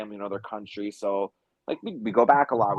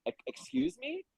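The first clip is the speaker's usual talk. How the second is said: high, softer stunned excuse me; much higher baffled excuse me; shocked excuse me; 14 semitones higher, 9 dB quieter than usual. Retold or imitated. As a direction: recoil at the offer, pitched way up. Shocked by massage offer.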